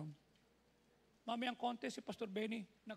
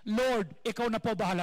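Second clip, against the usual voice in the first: louder, fuller call of the prayer; 13 dB louder than usual. Shown as a demonstration. Pastor modelling the prayer line for congregation, louder proclaiming. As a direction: proclaim it for all to repeat. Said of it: "Can you say that?".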